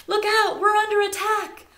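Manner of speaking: high, loud panicked bird cry; alarm call. Acted. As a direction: sound the alarm to the flock.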